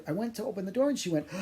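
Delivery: ordinary delivery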